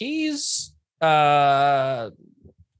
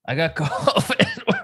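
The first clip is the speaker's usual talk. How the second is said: laughing admission; 7 semitones higher, slower than usual. Retold or imitated. Cousin laughing about covid.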